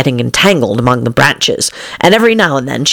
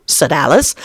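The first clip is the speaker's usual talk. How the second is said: ordinary delivery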